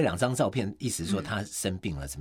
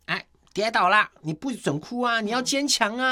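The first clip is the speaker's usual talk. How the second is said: high-pitched, louder mock parent voice; scolding parent; 13 semitones higher, 4 dB louder than usual. Parent to a child who fell, mock scolding. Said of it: "爸妈就会说".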